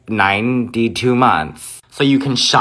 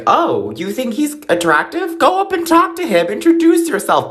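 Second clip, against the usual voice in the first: much higher, excited pushy friend voice; 12 semitones higher than usual. Retold or imitated. Imitating a pushy friend urging her to flirt, squealing high. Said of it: "those people who are like".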